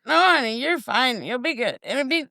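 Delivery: high, sugary reassurance to a nervous stranger; mock soothing. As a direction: pat her on the head with your voice, sweetly fake.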